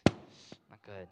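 whispered low disappointment; disappointed whisper. Real, not acted. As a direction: breathe it out, let down. Teacher's disappointed aside.

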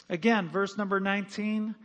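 normal talking voice

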